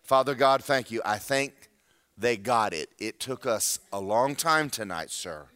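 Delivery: low, unhurried spoken prayer. Acted. Preacher thanking God for the congregation, slow low prayer. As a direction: talk slowly and intimately to God. Prays aloud.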